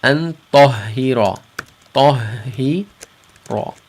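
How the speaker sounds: slow "an tahira" with a heavy ta; heavy ikhfa. Shown as a demonstration. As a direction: draw out "Tahira" heavily. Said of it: "tafkhim, meaning heavy".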